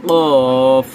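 কফ loud from the back of the tongue; Arabic ق loud and guttural; deep throat qaf. Shown as a demonstration. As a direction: hit কফ hard from the throat. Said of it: "কফটা উচ্চারণ হবে".

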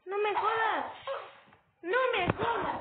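raging at the game; raging gamer. Real, not acted. Flag stolen, player rages.

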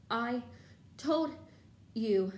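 one word at a time, gasped out; gasping. A narrator's reading. Hermione scolding her friends after running, gasping. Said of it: "Hermione gasped".

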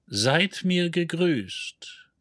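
slow, high and hollow, like a distant echo; a ghostly recorded greeting, drawn out. A narrator's reading. Dead planet's recording greets the ship, slow hollow voice. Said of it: "hohle, schrille, wesenlose Stimme".

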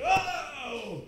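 high startled oh, imitating her jump; startled shriek. Cousin scared from behind, imitated high startled shriek. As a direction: squeal it high like the cousin did. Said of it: "And she would".

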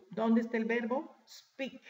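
usual speaking voice

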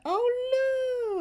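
high, loud surprised oh; delighted gasp. Real, not acted. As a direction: yelp with sudden delight. Rare pet hatches.

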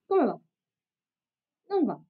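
ordinary talk

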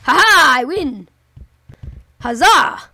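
loud victory whoop; triumphant cheer. Real, not acted. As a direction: cheer like a winner. Celebrating a win.

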